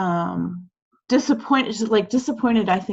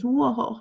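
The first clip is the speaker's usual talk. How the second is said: short, higher mock villain chuckle; a playful scheming mwahaha; little evil laugh; 5 semitones higher than usual. Teacher doing evil fingers for class, mock evil chuckle. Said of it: "I'm trying to do my little evil fingers".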